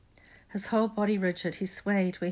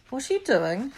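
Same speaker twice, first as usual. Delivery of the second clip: put-on higher, louder boy's question; startled questioning boy; 7 semitones higher, 6 dB louder than usual. A narrator's reading. Neville catches the others leaving, higher startled question. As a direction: ask it in a higher, puzzled voice.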